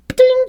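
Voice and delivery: high-pitched "ding"; ping out a high sound effect; imitated shot noise. Reader voicing a spider tracer being fired, imitated ping.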